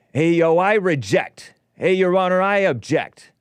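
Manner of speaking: mock New York street accent. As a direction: play a brash New York newsie objecting. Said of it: "He talked like he has this Boston or New York or something accent".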